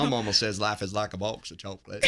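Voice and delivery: put-on laughing through a Southern American accent; Forrest Gump impression. Guest quotes Forrest's mama line, Southern accent. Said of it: "Forrest Gump fan".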